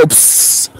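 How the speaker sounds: a soft unvoiced psst; imitated hiss. Musician retelling the spooky room, a whispered psst.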